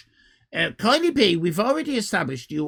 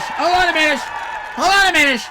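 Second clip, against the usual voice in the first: much higher, loud stop; surprised shout; 11 semitones higher, 8 dB louder than usual. Real, not acted. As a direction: halt everything in alarm. Streamer spots a flaw.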